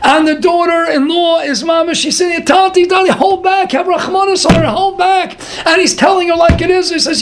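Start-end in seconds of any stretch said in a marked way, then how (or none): 2.4-5.4 high, urgent begging; pleading. Retold or imitated. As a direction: implore him in a high retold voice. Daughter-in-law begs him to hold back.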